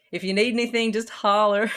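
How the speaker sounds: put-on Southern US accent, folksy and welcoming. Greeting the maintenance guy, hammed-up Southern accent. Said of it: "me becoming very southern".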